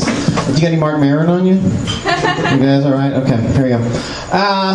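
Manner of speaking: ordinary talk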